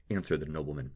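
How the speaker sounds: ordinary delivery, nothing marked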